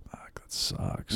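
hushed whispered curse; slow, soft, resigned whisper; whispered dismay. Retold or imitated. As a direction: breathe it out as a defeated whisper. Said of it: "And I'm like".

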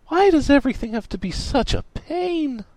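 high-pitched exasperated complaint. A narrator's reading. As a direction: complain as if utterly fed up.

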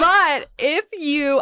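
spitting mid-word, slow and loud; words sputtered out with spit flying; spit take. Real, not acted. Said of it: "I just spit everywhere".

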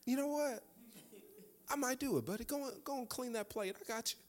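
high, hushed go-along voice; conspiratorial agreement. Retold or imitated. Dad agreeing to play Cash Three for son, conspiratorial hush.

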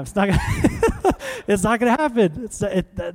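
real laughter breaking into the words; high-pitched, laughing through the sentence. Real, not acted. Laughs at his own absurd scenario.

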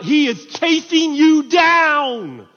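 loud, high, drawn-out proclamation; impassioned preaching. Real, not acted. Preacher tells congregation God is pursuing them, impassioned shout.

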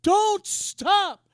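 loud insistent repeat; repeated command. Retold or imitated. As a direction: press the words home again. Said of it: "He said".

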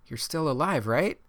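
high, urgent checking; anxious and breathless question; worried exclaim. Acted. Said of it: "Nico exclaimed".

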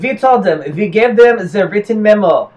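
earnest protest in mock German accent. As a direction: plead the case in a comic German accent. Acting Germans defending themselves.

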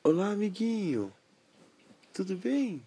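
high, bright pony voice; squeaky little pony greeting; talking pony. Acted.

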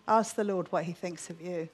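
ordinary talk, nothing marked

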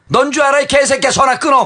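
high re-enacted phone outburst; angry yell. Politician hanging up on the reporter, angry yell. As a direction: yell it and cut him off, played for laughs.

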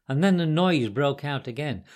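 plain reading voice

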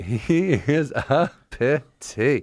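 slow, stretched mock disdain; laughing echo of uppity, stretched out; drawn-out echo. Real, not acted. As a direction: savour each word mockingly.

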